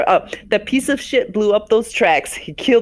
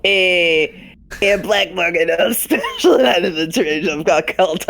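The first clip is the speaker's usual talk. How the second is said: a lightly done Chicago accent, laughing through it. Shown as a demonstration. A friend copies the Chicago accent while laughing. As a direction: copy a mock Chicago accent, cracking up. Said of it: "I can do Chicago".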